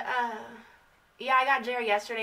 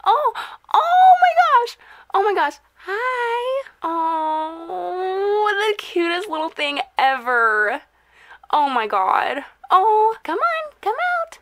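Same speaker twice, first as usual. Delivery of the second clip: much higher, louder squeal of adoration; 8 semitones higher, 8 dB louder, slower than usual. Real, not acted. Owner gushes loudly at her chinchilla to come out. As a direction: coo and squeal at the cute pet. Gushing over cute chinchilla.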